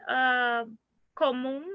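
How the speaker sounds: ordinary talk, nothing marked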